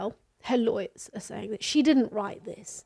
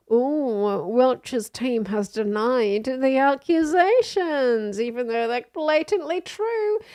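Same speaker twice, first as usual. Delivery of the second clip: ordinary talk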